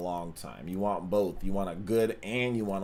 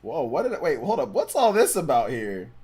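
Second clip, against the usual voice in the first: startled surprise; 6 semitones higher, 6 dB louder than usual. Real, not acted. Spots a strange headline.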